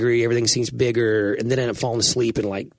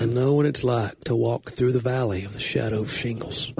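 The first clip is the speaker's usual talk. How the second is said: lightly solemn mock sermon line; a touch of grave pulpit drama; mock preacher. Pastor joking with cohost, mildly mock grave preaching. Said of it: "make that about the sermon".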